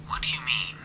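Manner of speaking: whispered radio voice; a hostile voice crackling over radio. A narrator's reading. Stranger on the radio telling them off.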